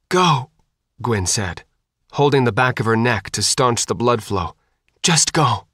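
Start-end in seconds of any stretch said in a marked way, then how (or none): 0.1-0.5 higher-pitched, urgent order; urgent command. A narrator's reading. Bitten woman orders him to leave. 5.0-5.7 higher-pitched, strained urging; urgent insistence. A narrator's reading. Bitten woman urges him to leave.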